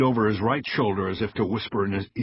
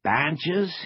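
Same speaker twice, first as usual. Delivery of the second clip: put-on higher, questioning Mexican accent; 10 semitones higher than usual. A narrator's reading. Agent mocks Cross's demand, Mexican accent. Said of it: "in his best Mexican accent".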